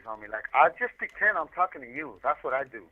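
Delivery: ordinary delivery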